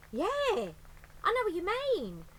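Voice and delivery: high, loud lady voice; eager agreement; Burglar Betty voice. Acted.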